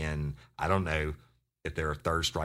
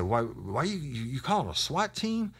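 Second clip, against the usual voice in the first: high, stammering mock disbelief; alarmed suspect; 9 semitones higher than usual. Suspect questions officer about swat, mock alarm. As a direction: imitate a rattled suspect in a high voice.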